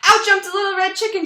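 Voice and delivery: high, loud and fast outburst; jumping into the story with a rush; excited burst. Acted. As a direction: burst in breathlessly. Chicken bursts into the tale.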